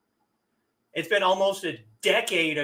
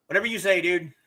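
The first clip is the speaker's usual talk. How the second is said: ordinary delivery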